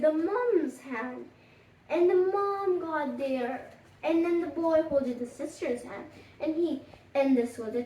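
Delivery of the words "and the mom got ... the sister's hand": usual speaking voice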